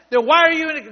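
very loud, high accusing question; infuriating demand. Retold or imitated. Healer badgering the man again, acted infuriating yell. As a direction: fire the question back, yelling. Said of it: "that, that, that infuriated me".

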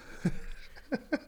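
breathy high-pitched giggle; a stoned, airy little laugh. Real, not acted. Stoned giggle after slip.

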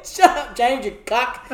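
usual speaking voice

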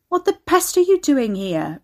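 loud, high outraged demand; shouted in alarmed anger; angry yell. A narrator's reading. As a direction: yell it at her. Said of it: "Ricky yelled at her".

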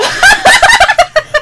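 shrieking laugh at the joke; squealing cackle; high delighted laugh. Real, not acted. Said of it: "Wow, you're dark".

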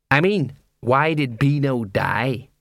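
slow, drawn-out question; lightly voiced watchman, asking slowly; puzzled questioning. Colon questions the clown, drawn out.

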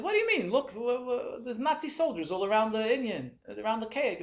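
high-pitched disbelief; incredulous protest. Acted. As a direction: protest as if the question were crazy. Acts out prisoner's incredulous reply.

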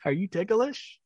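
put-on high, teasing question to a kid; creepy playful tickle tease. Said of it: "the tickle move".

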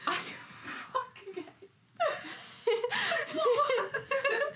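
slow words cracking into laughter; laughing fit. Real, not acted. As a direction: laugh until it's almost crying. Finds another fingernail, laughing.

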